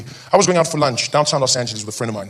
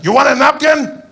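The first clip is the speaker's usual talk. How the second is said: high, loud order call; taco vendor; 13 semitones higher, 6 dB louder than usual. Acted. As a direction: sing out the order. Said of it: "He's like".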